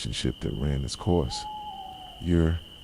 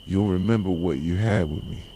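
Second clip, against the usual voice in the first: usual speaking voice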